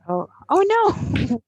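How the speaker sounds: high, slow startled oh no; surprised oh no. Real, not acted. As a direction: react with sudden alarm. Teacher reacts to mishap.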